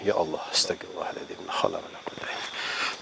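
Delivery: soft whispered protective prayer. Real, not acted. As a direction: murmur the prayer under breath. (bisikan doa perlindungan)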